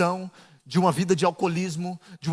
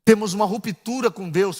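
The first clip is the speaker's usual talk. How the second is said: ordinary talk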